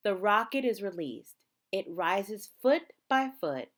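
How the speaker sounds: usual speaking voice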